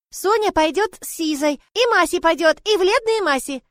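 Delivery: put-on a toddler announcing her plan; confident little girl. Little Sonya insists on going with Liza, high childish voice. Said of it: "уверенно заявила девочка".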